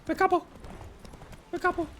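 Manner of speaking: very high squeal; squeaky high voice. Real, not acted. Escaping in game.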